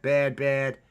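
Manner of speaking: usual speaking voice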